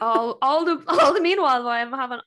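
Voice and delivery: loud, high, outraged rush of words; outraged burst. Real, not acted. Patient exploding at the memory of being dismissed, outraged.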